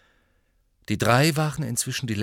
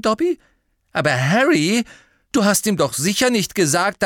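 indignant; 11 semitones higher, 7 dB louder than usual. A narrator's reading. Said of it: "Hermine sah empört aus".

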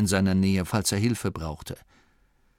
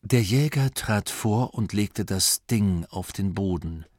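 plain reading voice

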